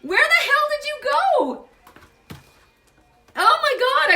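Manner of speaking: high, loud, amazed calls to viewers; astonished outcry. Real, not acted. Reader calling to viewers as a card vanishes, high astonished cry. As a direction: cry out in disbelief at the missing card.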